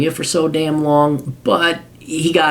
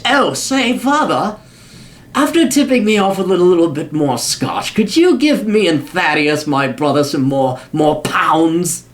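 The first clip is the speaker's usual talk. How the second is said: lilting posh British plea, higher, ending in a whispered pounds; snooty British rich kid; 4 semitones higher than usual. Pretend. Spoiled son asks father for pounds, snooty British accent. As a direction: play a pampered heir wheedling money from father. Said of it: "It still sounds snooty and British".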